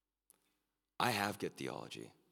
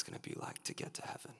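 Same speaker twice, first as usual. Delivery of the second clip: hushed intimacy; 12 semitones lower, 7 dB quieter, faster than usual. Real, not acted. Drops to intimate tone.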